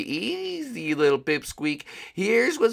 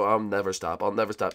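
sly taunt; 10 semitones lower, 7 dB quieter, faster than usual. Acted. Teasing, low and quiet.

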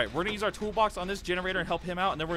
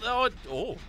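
ordinary talk, nothing marked

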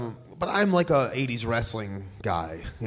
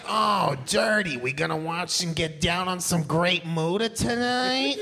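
higher, slow, drawn-out smart-ass buddy voice; imitating a skinny smart-ass friend; 5 semitones higher, slower than usual. Imitating his friend Lance inviting him to watch wrestling, higher and slow. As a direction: stretch it out like a cocky skinny kid. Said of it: "He kinda talks like this".

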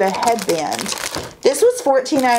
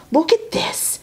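breathy whispered wonder, higher; awed whisper; 7 semitones higher than usual. Real, not acted. Showing viewers a product, awed whisper. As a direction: hush it like a secret treasure.

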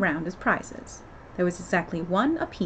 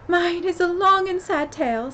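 sighing sad tale; 10 semitones higher, 5 dB louder than usual. A narrator's reading. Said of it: "turning to Alice and sighing".

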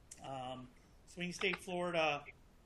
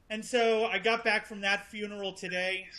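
louder, higher projected voice; speaking up; 5 semitones higher, 7 dB louder than usual. Real, not acted. Speaker asked to speak up for the audience, projected voice. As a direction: raise your voice so everyone hears. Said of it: "can you speak up".

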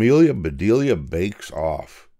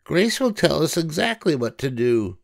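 high, cheerful storybook voice for Amelia; Amelia Bedelia voice; 9 semitones higher than usual. A narrator's reading. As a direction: lift into a bright girlish character voice. Amelia speaks.

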